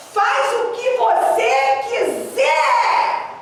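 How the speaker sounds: high, drawn-out mock furious shout; angry shout. Angry spouse giving up on the other, hammed-up shout. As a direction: shout it high, stretching every word. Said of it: "Ficou com raiva".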